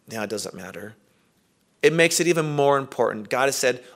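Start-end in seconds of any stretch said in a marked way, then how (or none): 0.1-0.9 dropped low and very soft, a careless throwaway; mumbled shrug. Retold or imitated. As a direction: mutter it like nothing matters. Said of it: "as opposed to a muting of".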